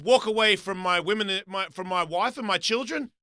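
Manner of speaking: retold outrage at a meddler; angry retort. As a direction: build a heated rant, punching the words.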